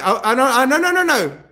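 stammered, high mock protest; acted flustered backpedalling; quick denial. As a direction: stumble into a hurried string of denials. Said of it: "he's, he's quick".

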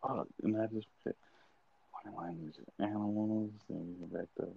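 very soft murmur to himself; thinking aloud. Real, not acted. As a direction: trace back your thought under your breath. Thinking aloud, recalling his question.

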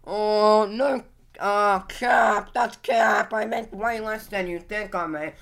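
mock MrBeast voice. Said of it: "Mister Beast".

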